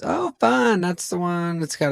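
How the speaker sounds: high, chirpy mock hostess voice; young hostess. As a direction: imitate an easily pleased young hostess.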